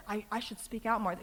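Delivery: ordinary delivery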